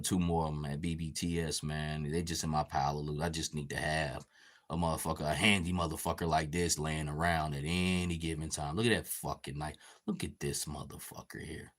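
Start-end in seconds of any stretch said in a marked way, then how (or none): none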